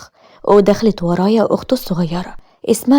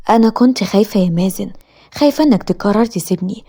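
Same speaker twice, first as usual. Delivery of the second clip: plain reading voice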